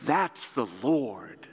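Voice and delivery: grab his friend's attention, then let it land; excited recognition. Retold or imitated. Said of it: "And he's like".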